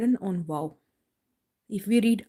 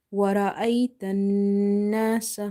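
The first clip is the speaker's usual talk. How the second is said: measured recitation with a long held nasal n; Quran recitation demo. Shown as a demonstration. Teacher modelling the verse for learners, measured recitation. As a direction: recite it evenly, holding doubled sounds.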